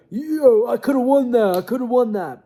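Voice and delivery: high-pitched mock protest; imitating complaining fighter. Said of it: "You go".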